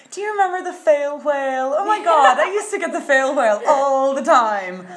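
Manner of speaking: high, loud nostalgic gush, laughing; sentimental gushing. Retold or imitated. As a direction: gush like a sentimental old fan. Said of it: "and you're like".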